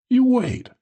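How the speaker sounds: high woman's voice, calling back. A narrator's reading. Woman calling back on her way out, high-pitched. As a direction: call it back, pitched well up. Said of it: "she called back".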